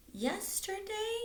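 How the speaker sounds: put-on very high, slow small-boy voice; little brother. Little brother answering his dad. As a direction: squeak it out like a toddler.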